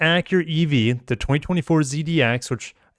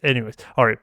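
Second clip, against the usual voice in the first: ordinary talk, nothing marked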